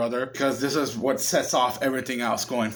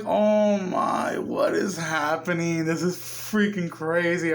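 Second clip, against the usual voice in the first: retold shock; 2 semitones higher, slower than usual. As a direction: replay your own stunned reaction to the scene. Retelling own shocked reaction watching.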